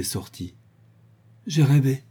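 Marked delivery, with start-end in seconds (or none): 1.5-2.0 a startled cry, a little louder and higher; exclaiming. Acted. Girl waking from a nightmare, mild startled exclamation. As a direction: blurt it out on waking. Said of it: "s'écria-t-elle".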